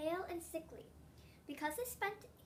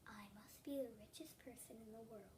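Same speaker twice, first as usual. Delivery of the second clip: soft unvoiced whisper, much softer; to herself; 3 semitones lower, 12 dB quieter than usual. A narrator's reading. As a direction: breathe it privately to herself. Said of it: "say to herself".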